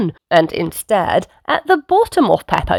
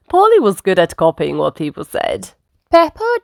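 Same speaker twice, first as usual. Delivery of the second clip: usual speaking voice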